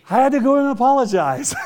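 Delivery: loud, laughing admission. Real, not acted. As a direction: laugh it out at yourself. Laughing at himself.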